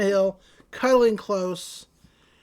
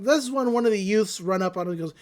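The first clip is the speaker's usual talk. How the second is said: ordinary talk, nothing marked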